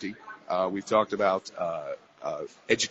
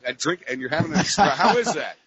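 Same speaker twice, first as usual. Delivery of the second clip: laughing; 6 semitones higher, 5 dB louder than usual. Real, not acted. Amused question about the espresso.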